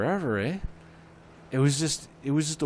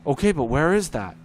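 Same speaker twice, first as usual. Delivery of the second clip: louder, the same baffled question again; repeated clueless question; 5 semitones higher, 7 dB louder than usual. Retold or imitated. Dispatcher asks the caller the same thing again, louder and baffled. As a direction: repeat it like you're stuck on a loop.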